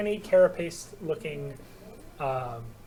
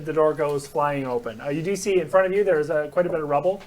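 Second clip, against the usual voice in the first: normal talking voice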